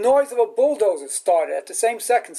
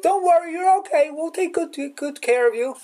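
much higher, gentle comforting voice; reassuring; 8 semitones higher than usual. Retold or imitated. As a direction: soothe the patient, kindly. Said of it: "the person looks at him and goes".

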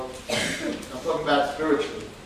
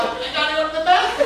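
laughing plea; 11 semitones higher, 4 dB louder, faster than usual. Retold or imitated. Pleading to escape MRI.

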